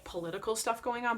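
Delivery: ordinary talk, nothing marked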